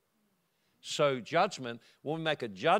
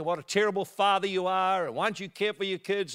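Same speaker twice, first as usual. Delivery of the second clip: mild mock scolding, raised and louder; lightly hammed-up telling-off; retold angry yelling; 5 semitones higher, 4 dB louder than usual. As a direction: imitate a neighbour telling someone off. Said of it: "And she's yelling at me".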